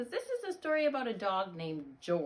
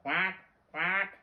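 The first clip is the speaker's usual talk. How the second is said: two louder, short quacks; duck quack; 5 dB louder than usual. Acted. Dog George answering the vet, duck quacks. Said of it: "And George went".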